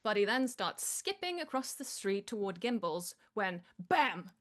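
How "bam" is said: normal talking voice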